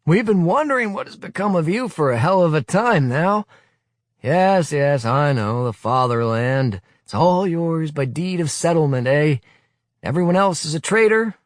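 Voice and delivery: scornful rebuke. Acted. Willy scolding the youth leader, scornful tirade. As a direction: tear into him with scorn.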